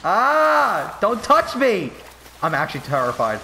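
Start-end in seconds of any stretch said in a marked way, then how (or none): 0.0-1.9 high, sudden yelp; startled yelp. Real, not acted. Player startled by a creature, high yelp. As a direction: flinch away with a cry.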